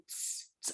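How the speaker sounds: soft unvoiced tss like opening a soda; a tiny hiss of released air; little tss burst. Shown as a demonstration. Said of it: "uma pequena explosãozinha de um Dzinho". (chiado de garrafinha de Coca-Cola abrindo)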